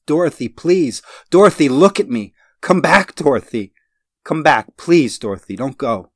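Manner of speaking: high, loud pleading screams; desperate yelling. Acted. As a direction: scream after her in despair. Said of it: "I tried to yell strongly".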